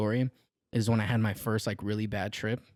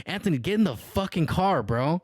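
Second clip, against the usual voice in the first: annoyed; 7 semitones higher than usual. Retold or imitated. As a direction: snap at him to get in the car now. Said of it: "I'm starting to get annoyed".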